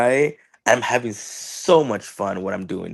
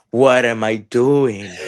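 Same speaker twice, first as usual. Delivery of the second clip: slow, incredulous plea; mock pleading; 3 dB louder, much slower than usual. Wrestler begging the champion for advice, slow disbelief. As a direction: drag out the disbelief.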